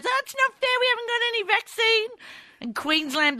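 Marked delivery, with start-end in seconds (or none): 0.0-2.1 put-on high, whining child's protest; whiny complaint. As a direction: whine like a sulking kid. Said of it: "Victoria gets an outbreak and goes".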